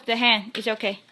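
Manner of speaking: soothing. Real, not acted. Soothing baby, very soft.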